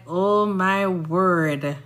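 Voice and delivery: slow, drawn-out exasperation; a weary "oh my word" at being misunderstood; exasperated. Retold or imitated. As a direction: let it sag in disbelief. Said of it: "And I'm like".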